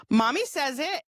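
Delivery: put-on high, innocent child tattling; little boy imitation. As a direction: imitate a small child telling on mommy. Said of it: "And he goes".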